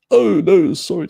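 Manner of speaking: high, loud burst of real sympathy; sudden dismay at bad news. Real, not acted. Reacting to a viewer's bad news.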